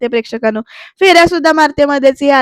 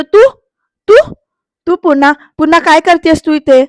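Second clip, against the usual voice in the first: louder, mock-indignant outburst; retold scolding yell; 4 semitones higher, 7 dB louder than usual. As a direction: act out yelling at someone who turned up. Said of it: "आरतीला ओरडतोय". (ओरडून बोलणे)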